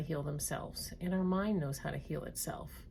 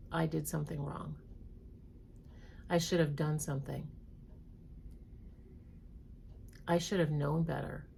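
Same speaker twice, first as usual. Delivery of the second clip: read slowly; 4 dB quieter than usual. Shown as a demonstration. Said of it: "I'm gonna read them slowly".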